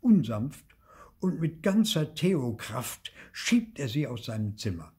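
usual speaking voice

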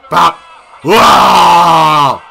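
loud, long-drawn "wow"; big falling shout of amazement; amazed shout. Real, not acted. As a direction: holler one stretched "wow". Loud reaction to goal.

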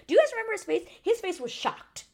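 high, loud excited question. Real, not acted. Vlogger asking viewers about Dom's face, excited and high. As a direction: share the shock with viewers. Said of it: "excited recap".

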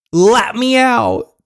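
high, loud, drawn-out yell; demanding yell. Acted. Captive girl wakes in a vampire's car, demanding yell. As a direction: holler it as a trapped captive.

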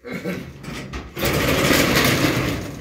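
breathy laugh. Real, not acted. Laugh after Brian's call.

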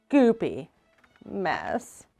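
ordinary delivery

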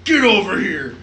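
loud growled villain yell; Scorpion shout. Retold or imitated. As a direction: shout it like a fighting game villain. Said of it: "Scorpion's one-liners in that".

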